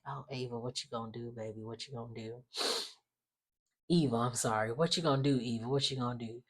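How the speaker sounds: soft, low mock-sorry croon; mock pity. Vlogger pitying Eva in a soft, low mocking croon. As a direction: coo it like pitying a child.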